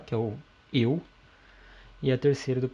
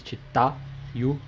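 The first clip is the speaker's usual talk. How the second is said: chitayu drawn out slowly, stressing "ta"; slow chitayu, middle syllable stressed, "yu" set apart; stress on the root. Shown as a demonstration. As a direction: lean hard on the "ta" of chitayu. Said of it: "era na raiz".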